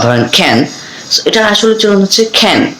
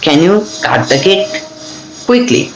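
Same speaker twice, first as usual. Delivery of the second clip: slow split drill; much slower than usual. Shown as a demonstration. Teacher breaks the drill sentence into two slow parts. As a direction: break it in two and lift the last word. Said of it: "এভাবে কিন্তু আমরা এটা পড়বো".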